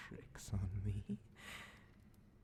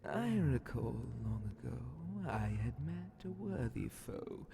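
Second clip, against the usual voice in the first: ordinary talk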